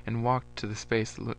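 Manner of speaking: usual speaking voice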